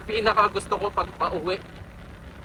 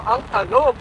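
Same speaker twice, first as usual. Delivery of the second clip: excited exclaim; 10 semitones higher, 3 dB louder than usual. Real, not acted. Reacting to bumpy road.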